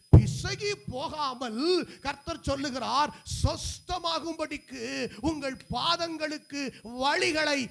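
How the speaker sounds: loud, impassioned sermon delivery; fervent preacher in full cry; full-force preaching. Real, not acted. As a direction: ring out each phrase to the congregation, drawing out key words.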